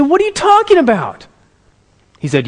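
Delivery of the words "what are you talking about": very high mock-teen disbelief; incredulous protest. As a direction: squawk it like a baffled son. Son disputes father's verdict.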